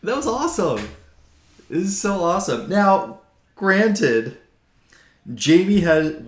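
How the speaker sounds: high, loud delighted gushing; excited. Real, not acted. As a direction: burst out with real delight. Gushing over fight outcome.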